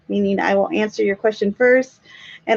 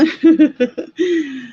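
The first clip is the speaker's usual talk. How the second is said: amused laugh at herself; 5 semitones higher than usual. Real, not acted. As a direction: break into a laugh. Laughs at own voice.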